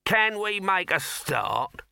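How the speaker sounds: high, slow, stern demand. A narrator's reading. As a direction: press them sternly, drawing out the words. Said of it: "said Dibbler sternly".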